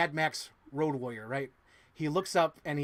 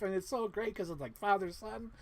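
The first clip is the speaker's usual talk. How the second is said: ordinary delivery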